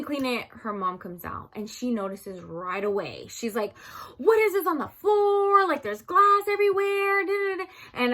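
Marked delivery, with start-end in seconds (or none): 4.2-7.7 high, loud mom voice; a strict mother spotting glass on the floor; alarmed strict mom. Retold or imitated.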